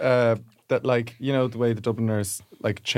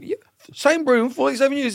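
imitating Trigger; 10 semitones higher than usual. Retelling Trigger's joke, lightly voiced. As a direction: ham up the proud sweeper. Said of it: "And he's like".

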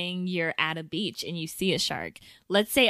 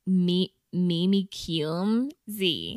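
slow; much slower than usual. Real, not acted. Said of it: "I just really wanted to hear you say that".

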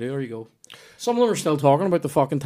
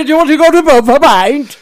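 booming, high, loud northern English bluster; Fred Elliott; 12 semitones higher, 10 dB louder than usual. Shown as a demonstration. Showing off an impression to friends, booming northern bluster. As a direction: bellow like a pompous northern character. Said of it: "I can do Fred Elliott".

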